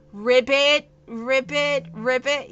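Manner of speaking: loud ribbit, ribbit frog croaks; croaking like a frog. Shown as a demonstration. Explaining why frogging is called frogging.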